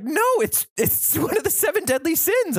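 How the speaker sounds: incredulous classmate. Retold or imitated. Classmates correcting him, incredulous.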